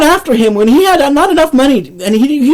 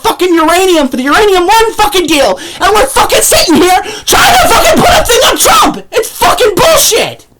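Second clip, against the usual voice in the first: much higher, yelling with a laugh; furious shout; 9 semitones higher than usual. Real, not acted. Ranting vlogger to viewers, a furious laughing shout. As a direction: blow up, shouting in real rage. Ranting about Trump coverage.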